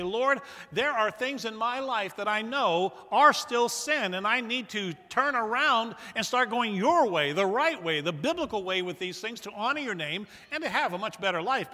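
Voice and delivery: loud, high pleading vow to turn; fervent prayer. Acted. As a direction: pray it with full conviction. Voices repentance prayer.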